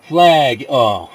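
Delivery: excited. Real, not acted. Ball heading at the flag.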